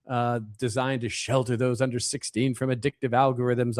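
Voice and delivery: normal talking voice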